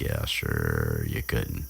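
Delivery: mock Ron brushing her off, low; sarcastic scoff.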